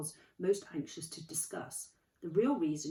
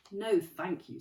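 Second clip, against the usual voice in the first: cold; 3 semitones higher, 6 dB louder, slower than usual. A narrator's reading. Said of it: "said Professor McGonagall coldly".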